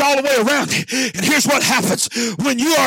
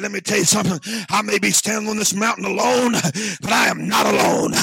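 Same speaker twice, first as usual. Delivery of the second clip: low, lightly voiced firm prophet; steady prophet; 6 semitones lower, faster than usual. Elijah tells the prophets he is not alone, low firm voice. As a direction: speak low and sure of God.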